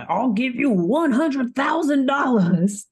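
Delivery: slow, high-pitched Southern gentleman drawl; Southern bayou accent. Acted. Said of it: "I can't do this accent forever".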